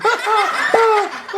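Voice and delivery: high hearty laugh at his own joke; big laugh. Real, not acted. Host cracks up at his own pun, high laugh. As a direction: burst out laughing.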